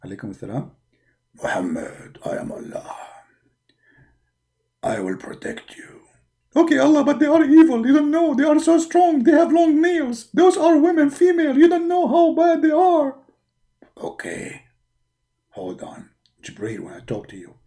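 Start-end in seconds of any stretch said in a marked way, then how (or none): 1.4-6.0 deep, low whisper from above; Allah's voice. Acted. 6.5-13.2 high, pleading, panicky complaining; whiny prophet. Acted. Muhammad voiced, panicking about wives. 14.0-17.6 deep, low whisper from above; Allah's voice. Acted.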